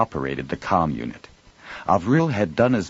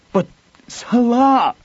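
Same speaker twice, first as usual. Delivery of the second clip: high, strained cry of anguish; anguished husband crying out; anguished demand; 7 dB louder, slower than usual. A narrator's reading. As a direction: plead his name in torment. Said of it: "Tarvi demanded in an anguished voice".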